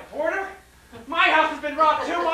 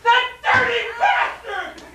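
furious shout; 9 semitones higher, 3 dB louder, much slower than usual. Acted. Husband raging at thieves.

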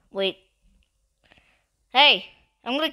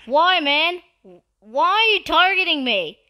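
raised, higher-pitched complaint; indignant protest; 6 semitones higher, 4 dB louder than usual. Real, not acted. Player to attacker who keeps targeting him, indignant protest. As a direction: protest loudly, pitch pushed up.

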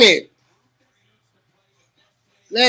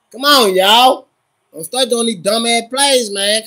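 usual speaking voice

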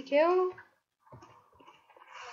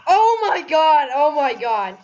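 loud startled exclaiming; loud excited; 9 dB louder than usual. Real, not acted. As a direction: blurt it out loud. Reacting to near death in game.

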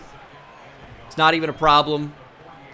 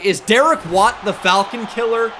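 incredulous shout; 6 semitones higher, 6 dB louder than usual. Real, not acted. Opponent's surprise run works.

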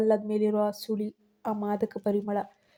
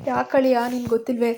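loud sarcastic jab of a question; 2 semitones higher, 5 dB louder than usual. A narrator's reading. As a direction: needle him, louder, with sarcasm. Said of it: "ವ್ಯಂಗ್ಯವಾಗಿ ಕೇಳಿದ".

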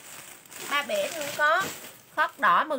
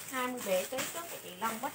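fast, soft mutter to herself while jotting the order; quick quiet mutter; 3 semitones lower, 9 dB quieter, faster than usual. Real, not acted. Seller writes the buyer's order down, muttering fast and low. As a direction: rattle it off under her breath, writing it down.

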